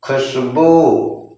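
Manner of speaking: Khushboo stretched out syllable by syllable; teacher reading Khushboo very slowly. Shown as a demonstration. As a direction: blend Khushboo slowly, sound by sound. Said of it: "तो क्या हो जाएगा?".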